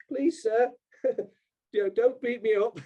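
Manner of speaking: high, laughing mock plea; mock pleading. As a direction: ham up a cowering beggar while chuckling. Mocking people who petition.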